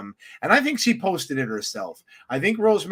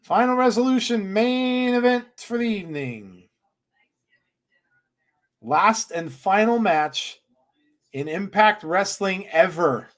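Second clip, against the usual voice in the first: ring announcer; 3 semitones higher than usual. Acted. Host announcing the final match ever, ring announcer style. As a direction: announce it grandly, stretching the key words.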